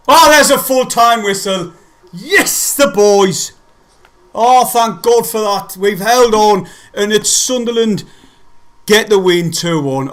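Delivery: loud, high cheering at the final whistle; jubilant celebration. Real, not acted. Fan celebrating the final whistle for Sunderland, loud and jubilant. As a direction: let the relief burst out loud. Full-time whistle, his team wins.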